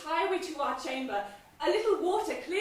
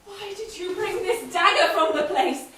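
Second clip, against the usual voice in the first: much louder, sharp scolding; angry rebuke; 4 semitones higher, 9 dB louder than usual. Acted. Wife scolding husband about the daggers, loud and angry. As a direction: berate him for his blunder.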